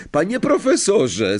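high tattling call. A narrator's reading. Pupil summons professor to complain, calling out. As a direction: call out to the teacher, pitched right up. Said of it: "zawołał Malfoy".

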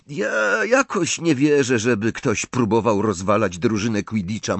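ordinary delivery, nothing marked

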